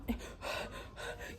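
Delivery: long breathy exhausted sigh; weary heavy breath. Retold or imitated. Worn out under her in the fight, heavy sigh. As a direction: huff out air as if worn out. Re-enacts being tired, pinned.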